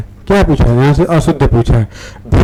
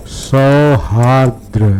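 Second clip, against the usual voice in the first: slow spelling read; much slower than usual. Shown as a demonstration. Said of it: "धीरे-धीरे वर्तनी पढ़ना".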